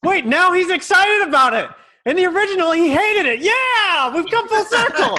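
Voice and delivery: delighted near-shout; laughing excitement. Real, not acted. Actor cracking up at the changed scene, loud laughing delight.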